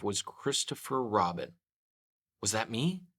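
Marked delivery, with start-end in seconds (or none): none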